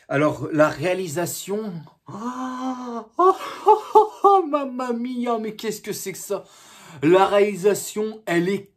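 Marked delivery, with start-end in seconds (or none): 2.1-6.4 a real theatrical shock at the footage; shocked outcry. Real, not acted. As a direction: gasp out mamma mia in horror. Shocked reaction to bad filming.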